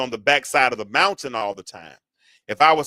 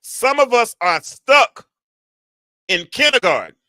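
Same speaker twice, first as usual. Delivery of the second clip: emphatic preaching shout; 6 semitones higher, 8 dB louder than usual. Real, not acted. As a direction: hammer it home to the congregation. Preacher repeats line for emphasis.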